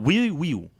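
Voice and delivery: "really real" as "wheely wheel"; "real" said with a w sound; says w for r. Shown as a demonstration. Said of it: "If you can't say your R's".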